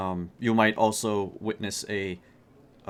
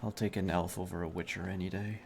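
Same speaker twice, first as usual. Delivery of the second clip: hushed, hurried mutter; muttering under his breath; 9 dB quieter, faster than usual. Acted. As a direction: mutter it quickly to yourself. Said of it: "mutter under his breath".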